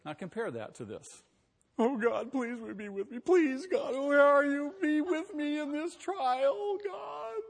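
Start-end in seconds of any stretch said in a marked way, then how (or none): 1.8-7.4 high, loud, pleading mock wail; mocking whiny prayer. Preacher mocks a fearful believer begging God, high whiny wail. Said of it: "I mean to mock that".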